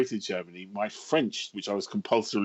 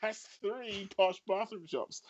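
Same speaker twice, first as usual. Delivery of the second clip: incredulous thought; 9 semitones higher, slower than usual. Retold or imitated. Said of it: "and I thought".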